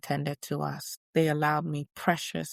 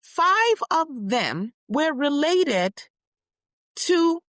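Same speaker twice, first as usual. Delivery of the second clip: raised, rousing public address; stirring rally speech, higher and louder; impassioned speech; 9 semitones higher, 6 dB louder than usual. Acted. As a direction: speak out to the crowd with conviction.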